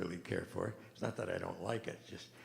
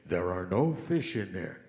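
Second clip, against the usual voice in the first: lower, louder, slow booming voice; big voice; 4 semitones lower, 6 dB louder than usual. Retold or imitated. Joke's mysterious voice booming at the ice fisherman. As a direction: boom it like a voice from above. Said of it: "he heard this big voice say".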